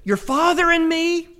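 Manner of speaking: anxious mother scolding loudly; exasperated mother. Retold or imitated. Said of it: "And his mother's like".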